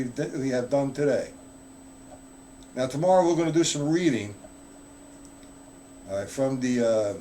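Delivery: ordinary delivery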